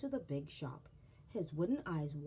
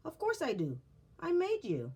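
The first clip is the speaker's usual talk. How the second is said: proud maker's voice; 10 semitones higher, 5 dB louder than usual. Acted. Eli's proud reply.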